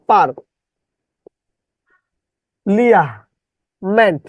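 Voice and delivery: পার, লিয়া, মেন্ট in separate chunks with long pauses; distorted spelling pronunciation. Shown as a demonstration. Teacher showing a spelling trick, distorted syllable-by-syllable pronunciation. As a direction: break it up as পার... লিয়া... মেন্ট, sounding the "lia". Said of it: "এটাকে বিকৃতভাবে উচ্চারণটা".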